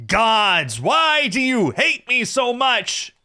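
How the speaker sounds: high, loud, drawn-out lament; overblown, slow howl of complaint; anguished cry to gods. Acted. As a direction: wail at the heavens in theatrical despair. Cries out to the gods.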